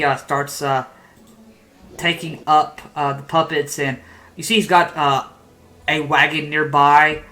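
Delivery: ordinary delivery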